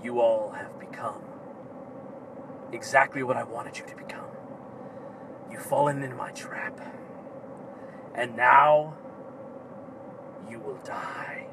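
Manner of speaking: low, hushed villain voice; booming echoing villain monologue. Acted. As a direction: menace the heroes in a low hush. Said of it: "And he says".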